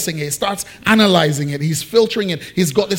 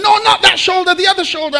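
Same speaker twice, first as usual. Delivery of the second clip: much higher, correcting wife voice; impatient wife; 10 semitones higher, 4 dB louder, faster than usual. Retold or imitated. Wife in labour pains correcting clueless husband, high and impatient. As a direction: play the wife snapping back a correction.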